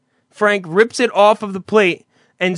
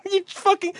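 ordinary talk

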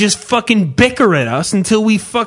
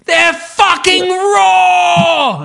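much higher, loud slow mock shout; angry chef yelling; 12 semitones higher, 3 dB louder, much slower than usual. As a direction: bellow about raw ribs like a raging TV chef. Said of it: "Clayton just walks around and says".

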